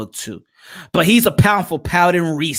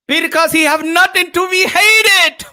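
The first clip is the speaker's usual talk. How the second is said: laughing shout; 4 dB louder than usual. Acted. Laughing mock voice.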